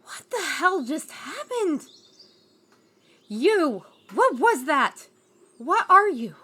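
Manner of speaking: loud alarmed demands; shocked shout. Acted. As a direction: yell at the stranger in disbelief. Red shocked after stranger transforms.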